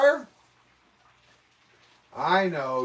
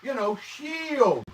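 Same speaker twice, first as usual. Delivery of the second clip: "shield" pitched high and drawn out slowly; slow, emphatic "shield"; stress on shield; 6 semitones higher than usual. Shown as a demonstration. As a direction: lean hard on "shield". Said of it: "wind shield".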